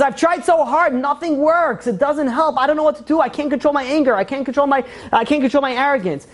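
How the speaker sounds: high, imitated wail of helplessness; retold tearful despair. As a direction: pour it out to mom, acting distraught. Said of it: "he starts crying over to her".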